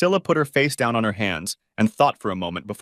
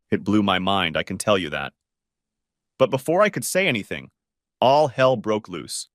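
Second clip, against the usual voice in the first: usual speaking voice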